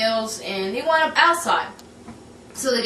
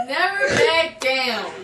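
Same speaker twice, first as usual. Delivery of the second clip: slow, higher, hammed-up trailer voice; dramatic title read; 5 semitones higher, slower than usual. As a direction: drag out the movie title like a trailer. Announcing the movie title.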